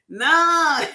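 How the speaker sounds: high, loud, playful "nah" of protest; retold protest. Her replying to the manager who pitied her, playful protest. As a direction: stretch it up high in mock denial. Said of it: "I was like".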